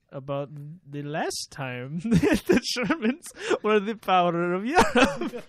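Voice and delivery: mock German official, slowed and laughing toward the end; imitating a stiff official, cracking up by the end; lightly voiced official who breaks into laughter. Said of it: "one of the German officials had, had this to say".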